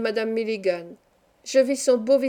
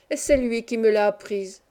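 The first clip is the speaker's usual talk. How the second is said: ordinary delivery, nothing marked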